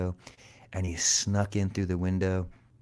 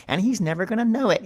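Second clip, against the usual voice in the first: high, quick sneaky mouse voice with giggles; gloating mouse; 5 dB louder, faster than usual. A narrator's reading. Mouse to himself about fooling Cat, high gleeful gloating. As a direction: gloat in a fast squeaky giggle.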